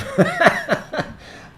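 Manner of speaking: breathy unvoiced laugh; real laugh. Real, not acted. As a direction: laugh it off lightly. Laughs at own joke.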